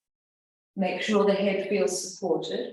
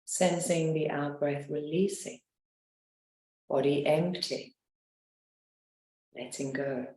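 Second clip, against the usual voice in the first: guided meditation; 2 semitones lower than usual. Acted. Leads a guided relaxation.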